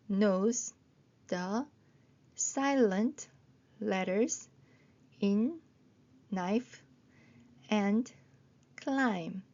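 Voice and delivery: very slow, a pause after each word; word-by-word reading. Shown as a demonstration. Teacher reading the second long sentence, word by word. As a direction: sound out one word at a time. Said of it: "두 번째 문장 읽어보겠습니다".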